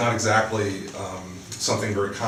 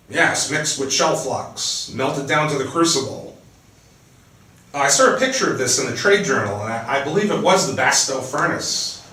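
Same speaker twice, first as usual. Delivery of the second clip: livelier, higher reading voice for the book; dramatic novel reading, louder and higher than her chat; story narration; 4 semitones higher, 5 dB louder than usual. A narrator's reading. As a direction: read the scene aloud like an author at a reading. Author reads her novel aloud.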